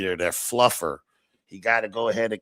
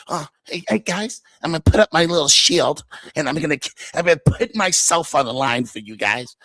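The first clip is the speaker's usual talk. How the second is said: mocking imitation; 5 semitones higher than usual. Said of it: "You think you gonna".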